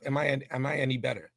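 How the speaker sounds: ordinary talk, nothing marked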